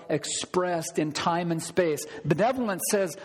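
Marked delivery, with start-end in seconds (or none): none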